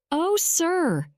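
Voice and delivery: high, pleading cry of Oh, sir. A narrator's reading. Toad begs the train driver, high pleading cry. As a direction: Toad wails at the driver for pity. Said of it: "cries Toad".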